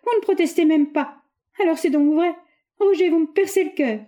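high, loud heartbroken cry; a young woman crying out she is betrayed; anguished reproach. A narrator's reading. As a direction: let the heartbreak ring out. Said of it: "s'écria-t-elle".

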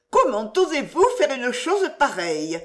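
high, outraged lady's question; scandalized protest, pitched well up; indignant cry. A narrator's reading. Widow protesting at being lifted and kissed, indignant cry. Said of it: "s'écria mistress Glenarm".

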